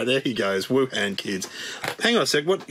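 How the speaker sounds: normal talking voice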